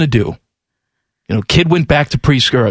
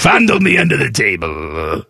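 low, hammed-up celebrity impression; Larry King; 6 semitones lower than usual. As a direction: drop the pitch into a put-on talk-host voice. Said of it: "Larry King turned into Jiminy Glick".

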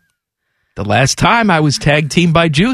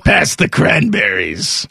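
slow, drawn-out old-man impression; Larry King; slower than usual. Holiday dinner guest addresses his sister-in-law, slow impression. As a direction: stretch it out in a put-on voice.

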